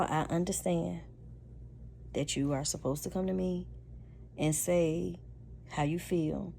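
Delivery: normal talking voice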